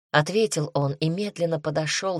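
usual speaking voice

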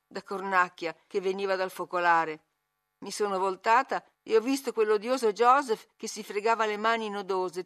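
usual speaking voice

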